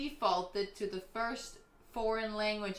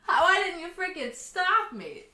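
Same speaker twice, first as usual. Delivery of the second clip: higher, louder frustrated cry; blurting out in frustration; mock exasperated outburst; 7 semitones higher, 7 dB louder than usual. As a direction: inner frustrated yell, played up. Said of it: "in my brain I was like".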